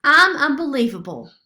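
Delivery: loud, high boast; crowing. A narrator's reading. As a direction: brag with a swagger. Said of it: "Sophia crowed".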